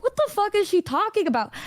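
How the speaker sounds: high-pitched, incredulous inner reaction; baffled disbelief. Retold or imitated. Storyteller to herself about her friend, retold baffled disbelief.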